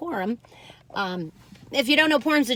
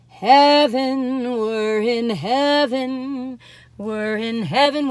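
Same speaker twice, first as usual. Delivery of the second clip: blissful swoon; 5 dB louder, much slower than usual. Real, not acted. Blissful over bread.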